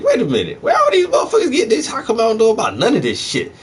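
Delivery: high, fast, squealing disbelief; high-pitched rushed outrage at being kept in the dark; shocked disbelief. Retold or imitated. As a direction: act floored by what he just learned. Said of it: "And I was like".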